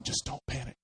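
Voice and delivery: hushed urgent reminder underwater; whispering, trying not to panic; whispered self-talk. Retold or imitated. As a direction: whisper to yourself to stay calm. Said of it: "I had this moment where I was like".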